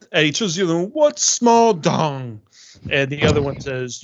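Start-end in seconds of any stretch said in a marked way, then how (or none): 0.9-2.4 higher, very slow ogre question; puzzled ogre. Acted. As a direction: drag out the question like a dim ogre. Game master voices confused ogre.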